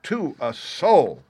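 squire's emphatic, stretched-out denial; indignant cry. A narrator's reading. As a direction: insist slowly, punching the last word. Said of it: "cried the squire".

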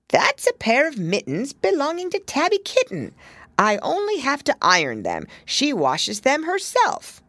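high, bobbing little washerwoman's voice; little hedgehog laundress curtsying to Lucy. A narrator's reading. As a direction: answer with a quick curtsy, eager to please.

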